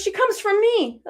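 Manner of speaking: high-pitched wry grandmother imitation; mock sarcasm.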